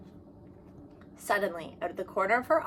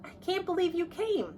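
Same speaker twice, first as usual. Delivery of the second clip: put-on amazed girl; 10 semitones higher than usual. A narrator's reading. Surprised friends arrive.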